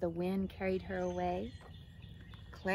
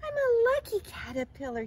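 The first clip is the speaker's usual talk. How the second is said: high, loud gleeful exclamation; elated little caterpillar voice; Clara's delight; 7 dB louder than usual. A narrator's reading. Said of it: "she said".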